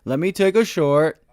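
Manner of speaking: high, loud, mocking know-it-all trader; mocking an eager trader. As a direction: ham up the overeager dip-buyer. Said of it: "'Cause you're like".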